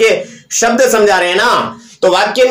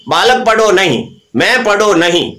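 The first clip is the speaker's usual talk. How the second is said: ordinary talk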